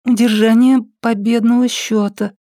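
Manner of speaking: high, drowsy voice; half-asleep girl murmuring; sleepy girl. A narrator's reading. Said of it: "уже сонная".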